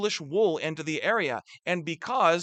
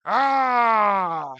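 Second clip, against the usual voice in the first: loud angry growl, higher pitch; French growl; 4 semitones higher, 5 dB louder than usual. Shown as a demonstration. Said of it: "I don't know if that's a very French growl".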